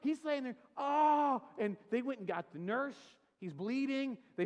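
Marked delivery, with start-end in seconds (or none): 0.8-1.4 mock groan. Friend lying hit on ground.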